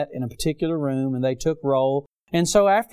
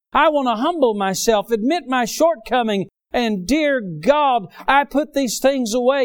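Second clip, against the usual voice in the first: preached high and louder, rising to a cry; fervent preaching; 6 semitones higher, 3 dB louder than usual. Acted. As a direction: press each line home from the pulpit. Preaching at full force.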